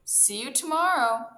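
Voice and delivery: loud, cocky call back; rude shout. A narrator's reading. Hare zooming off from the turtle, shouting rudely. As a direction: shout it rudely over the shoulder. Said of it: "shouting rudely".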